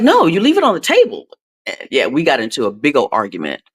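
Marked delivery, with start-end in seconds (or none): 0.0-1.3 high, louder insistent retort; mock arguing. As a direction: replay a squabble, pitched up and emphatic. Acts out argument with granddaughter.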